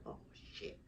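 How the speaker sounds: breathless whispered curse; whispered oh shit. Retold or imitated. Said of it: "remembering scary moment".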